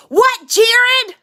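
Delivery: high, loud mock-exasperated reply; exasperated answer. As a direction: answer his call with loud impatience. Acted bickering, wife answers call.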